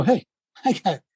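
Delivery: laughing retort. Retold or imitated. Coach retelling his own retort, laughing.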